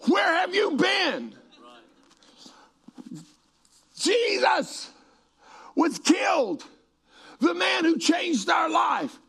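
very high, hammed-up anguished wail; acted weeping cry. Two grieving disciples answering Jesus, hammed-up weeping cry. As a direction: cry out in mock grief, pitch way up. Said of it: "they're weeping, and they're crying".

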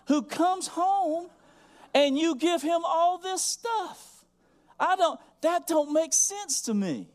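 high, loud, slow outraged complaint; mock-offended pastor protesting, loud and high; indignant preacher. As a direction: play yourself affronted at the unfairness. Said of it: "I'd looked at E D and said".

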